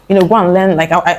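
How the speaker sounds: ordinary talk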